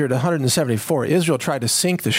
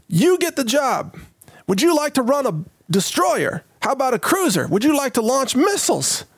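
mock navy officer; 8 semitones higher than usual. Host mocks the Navy hiring a recruit who can't do math. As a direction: hand out the job like a cartoon officer.

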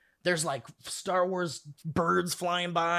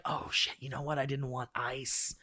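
muttered aside; 3 semitones lower, 6 dB quieter than usual. Pretend. Worker breaks character, muttering.

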